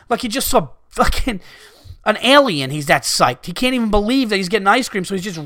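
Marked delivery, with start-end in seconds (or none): none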